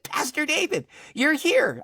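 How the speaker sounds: high-pitched astonished couple; retold amazement, way up high; amazed greeting. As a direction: imitate people stunned by an unexpected visitor. Voices couple amazed to see him.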